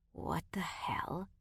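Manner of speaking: breathless stunned whisper; trailing whisper. A narrator's reading. Roy, stunned by the gunfire, whispers trailing off. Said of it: "Roy trailed off".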